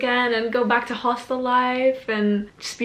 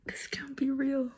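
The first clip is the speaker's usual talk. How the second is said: whisper; 4 semitones higher, 7 dB quieter than usual. Real, not acted. Disbelief at visa grant.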